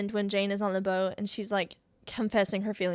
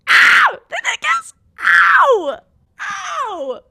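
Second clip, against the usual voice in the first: very high, loud squealing "Ow!" and "Then they kiss"; fangirl shrieks; 13 dB louder, much slower than usual. Real, not acted. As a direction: squeal with overwhelmed delight. Fan shrieking at kiss scene.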